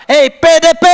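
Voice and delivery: shout. Retold or imitated. Calling out to the quarrelling man.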